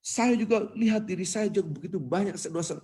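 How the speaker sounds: high, urgent confession from the pulpit; retold preacher turning the sermon on himself; emotional preacher. Khotib admitting the advice is for himself too, emotional. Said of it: "Sampai menangis khotib itu".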